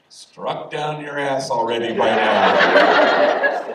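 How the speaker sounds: laughing punchline. Retold or imitated. Homie joking about Genesis days, laughing. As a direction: break into laughter on the line. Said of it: "we just howled".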